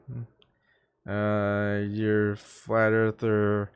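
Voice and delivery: slow, drawn-out, pondering hum; mock ponderous reply. Answering people who know him, slow musing voice. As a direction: stretch it out slowly like you're mulling it over. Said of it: "And I go".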